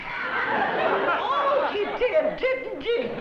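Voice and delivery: high, laughing embarrassed realisation; flustered surprise. Acted. Interpreter realises he spoke English, flustered laugh. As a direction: cover the slip with a giggly oh.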